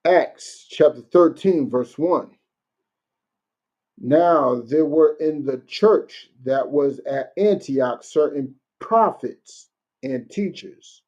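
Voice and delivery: slow, deliberate Bible reading; measured scripture read. Acted. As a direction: read the verse out slowly to the congregation. Reads scripture aloud.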